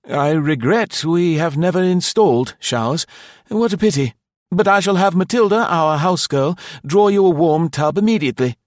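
high, loud, apologetic innkeeper; apologetic host. A narrator's reading. Host explains to guest there are no showers, apologetically. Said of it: "Chalmers looked apologetic".

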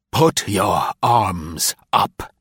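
slow, clenched command through the teeth; ordered through teeth. A narrator's reading. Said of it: "Tilson ordered through his teeth".

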